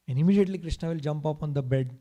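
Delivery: ordinary delivery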